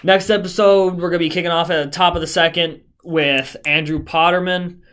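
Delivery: loud, upbeat podcast host sign-off; show host outro. Acted. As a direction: announce the next episode like a host.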